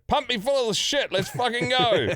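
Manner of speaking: high, pumped-up bravado; cocky hype. Retold or imitated. Patient telling the doctor to bring it on, hyped and high. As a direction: fire yourself up for the scan. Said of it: "So I respond like".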